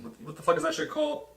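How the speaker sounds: high frustrated squeak; exasperated. Real, not acted. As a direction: voice jumps up, fed up. Searching for a word, exasperated.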